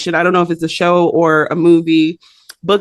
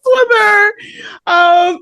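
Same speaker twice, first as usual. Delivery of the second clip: ordinary delivery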